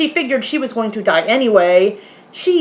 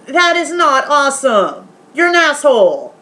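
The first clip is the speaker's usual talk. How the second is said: indignant retort; 5 semitones higher, 3 dB louder than usual. Retold or imitated. Acted indignant retort to the friend.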